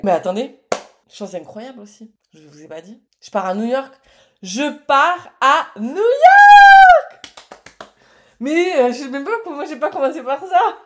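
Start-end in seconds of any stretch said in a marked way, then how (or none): 4.4-7.3 high, very loud drawn-out scream of joy; real shriek of excitement; ecstatic shout. Real, not acted. Shrieks out the big news again. 8.4-10.9 high, loud, laughing through the words; real giddy squeal of laughter. Real, not acted. Giddy after shouting her big news.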